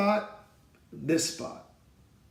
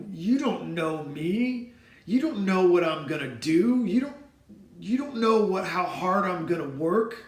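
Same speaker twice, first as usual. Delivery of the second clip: retold defiance, louder and higher as it builds; angry defiance; 3 semitones higher, 6 dB louder than usual. A boy told he won't play ball again, defiant and angry. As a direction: throw it back at him like a challenge. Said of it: "going from sadness to anger".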